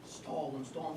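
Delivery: urgent hushed aside; hushed urging. Acted. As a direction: stall 'em said low and hushed, almost under the breath. Urging wife while she's on phone.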